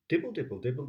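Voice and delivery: usual speaking voice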